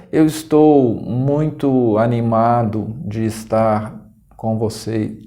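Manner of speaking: slow, lifeless claim of excitement, fading away; utterly unenthusiastic. Shown as a demonstration. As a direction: drag it out with zero enthusiasm. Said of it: "Não tá animado de jeito nenhum".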